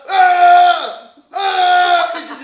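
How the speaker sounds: two loud high screams; loud scared whoops mid-jump. Retold or imitated. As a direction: yell out like you're falling. Bungee jump screaming retold.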